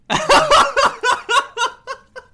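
loud, high cackle of laughter; high burst of laughter. Real, not acted. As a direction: break into a big laugh. GM cracks up at question.

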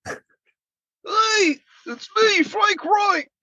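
high squeaky mock coach voice; Frank Reich impression. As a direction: pitch way up, goofy impersonation. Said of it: "is that what he sounds like".

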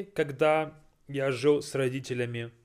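ordinary talk, nothing marked

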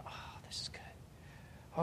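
breathless whisper, barely there. Retold or imitated. Alone on a hill, feeling God's presence, whispered. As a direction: hush it out as if in awe. Quiet worship moment with God.

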